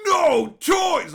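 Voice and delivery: imitating Santa's huge angry shout, high and loud; hammed-up furious yell. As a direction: bellow it like an outraged Santa. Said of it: "he is so mad".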